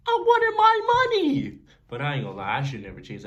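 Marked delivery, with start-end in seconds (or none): 0.1-1.6 high, loud protesting cry; indignant yell. Retold or imitated. Kid answering his worried mom, high loud protest. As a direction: yell it back in frustration.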